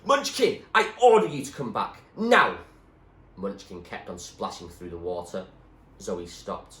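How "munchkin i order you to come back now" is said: loud, high commanding yell; yelling order. A narrator's reading. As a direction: bellow the order. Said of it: "yelling his head off".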